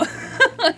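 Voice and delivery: a bright burst of laughter; cracking up at the dog voice; laughing after voicing the excited dog; high laugh. Real, not acted. Laughing at her own impression.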